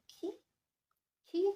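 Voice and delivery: qui lifted like a question; questioning intonation. Shown as a demonstration. Teacher reminding class it is interrogative, questioning qui. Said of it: "remember it is interrogative".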